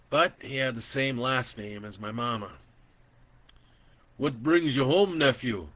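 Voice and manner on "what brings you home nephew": a hint of a thick Native accent. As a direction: lightly lean into a Native uncle's accent. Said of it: "in that thick native accent".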